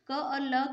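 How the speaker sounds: क्ल said as one blended sound, no vowel between क and ल; teacher demonstrating the क्ल blend. Shown as a demonstration. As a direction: glide क straight into ल as क्ल. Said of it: "इसको कल नहीं पढ़ते हैं, क्ल पढ़ते हैं".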